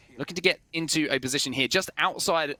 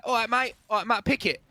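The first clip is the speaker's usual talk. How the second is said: high, chirpy "All right, mate, all right, Matt Pickett."; mock cheerful British greeting; imitating Matt Pickett; 8 semitones higher than usual.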